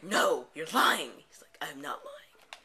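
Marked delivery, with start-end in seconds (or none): 0.0-1.1 loud, slow, drawn-out accusation; hammed-up outraged shout; dramatic denial. Acted argument with father. 1.6-2.6 understated reply, half whispered; hushed denial. Acted. Father's first denial.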